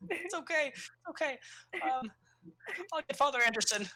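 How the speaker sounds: put-on small, high reassuring child voice; high kid voice. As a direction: comfort her as a shy little boy. The scared kid reassures her.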